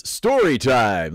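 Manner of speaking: put-on slow, over-accentuated announcer call; hammy announcer, savoring the sound; over-the-top announcer. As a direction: stretch the words ridiculously. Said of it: "over accentuating the ridiculous".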